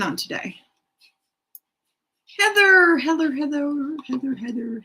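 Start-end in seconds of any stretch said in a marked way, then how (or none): 2.4-4.8 calling out a name. Real, not acted. Calling out to get Heather's attention.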